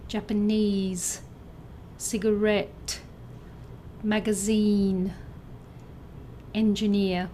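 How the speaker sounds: "Japanese. Cigarette. Magazine. Engineer.", each stressed on its last syllable; third-syllable stress. Shown as a demonstration. Teacher reads out answers, third-syllable stress from "Japanese" to "Engineer". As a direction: model each word separately, landing on the end of "Japanese", "Cigarette", "Magazine", "Engineer". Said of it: "with a stress on the third syllable".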